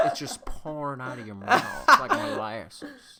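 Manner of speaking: put-on slow, loud Savannah accent, half laughing. Showing friend The Office bit, laughing mock Savannah accent. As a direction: pour it out like molasses, hammed-up Savannah. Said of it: "It's a Savannah accent".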